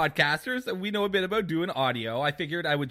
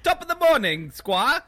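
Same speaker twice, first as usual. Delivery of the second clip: mock British accent; 12 semitones higher, 4 dB louder than usual. Shown as a demonstration. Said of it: "you should be able to do it".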